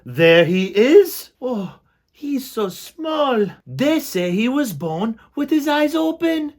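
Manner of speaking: high, slow, squeaky mouse gossip; gossiping mice. Acted. Crowd of mice gossiping about Despero. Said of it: "the mice whispered".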